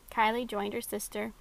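usual speaking voice

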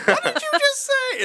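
shrill mock of a caught-out sibling; imitating sister. Sister catches her in mirror.

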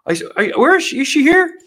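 mocking Biden looking for Jackie; imitating Biden. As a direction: stammer and peer around confused. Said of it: "And he said".

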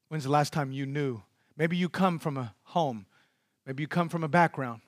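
usual speaking voice

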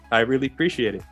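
ordinary delivery

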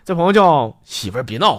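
usual speaking voice

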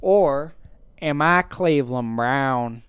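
low, drawn-out cartoon voice; slow it right down in the character voice; Cleveland Brown. Podcaster having another go, low character impression. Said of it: "It's not even a good impression".

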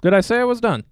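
loud, high-pitched indignation; indignant protest. Real, not acted. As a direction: object that you were cut off. Protesting being cut off.

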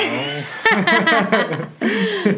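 long burst of real laughter; real, drawn-out belly laugh; amused laugh. Real, not acted. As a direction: crack up laughing at your own teasing. Laughing at own quiet-child quip.

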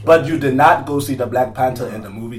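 put-on African accent; playful scolding in a mock African accent. Host pressing co-host about the movie, mock African accent. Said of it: "say that with my African accent".

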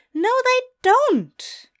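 high, loud, drawn-out denial; frustrated contradiction. Acted. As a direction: contradict him in shrieky exasperation. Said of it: "shook his head in frustration".

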